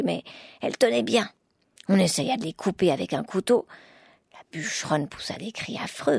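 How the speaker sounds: plain reading voice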